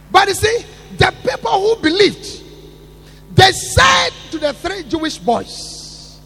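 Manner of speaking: loud, high preaching cry; preaching shout. Acted. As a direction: thunder it from the pulpit. Preaching at full force.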